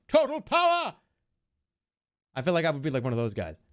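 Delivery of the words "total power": high, hammed-up power cry; over-the-top hype. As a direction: belt it out like a catchphrase. Shouting a hyped catchword.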